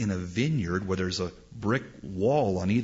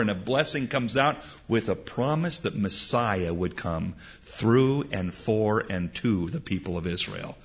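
usual speaking voice

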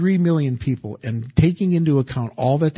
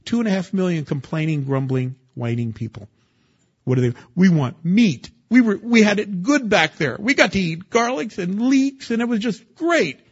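mock whining; 6 semitones higher than usual. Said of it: "And you're like".

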